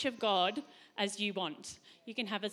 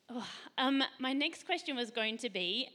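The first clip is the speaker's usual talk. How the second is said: ordinary delivery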